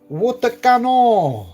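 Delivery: drawn-out, high mock French accent. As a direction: stretch it out in a put-on French accent. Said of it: "say it in a French accent".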